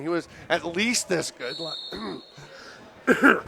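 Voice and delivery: slow, failing voice mid-sentence; voice giving out. Real, not acted. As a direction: lose your voice as you talk. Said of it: "my voice just went away".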